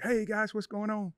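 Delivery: high, bright mock fan greeting; cheery camera greeting. Ex-player mimicking his high cheery greeting to fans on camera. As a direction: flip on the happy camera voice. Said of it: "get in front of a camera".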